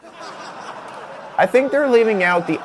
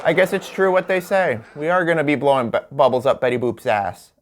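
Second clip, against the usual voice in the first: normal talking voice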